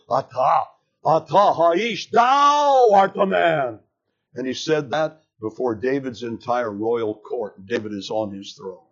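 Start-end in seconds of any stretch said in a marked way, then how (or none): 0.1-3.8 slow, louder and higher, "atah" then "Thou" punched hard; emphatic accusation. Acted. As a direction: build from "Atah" to a ringing "Thou art the man". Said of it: "It's emphatic in the Hebrew".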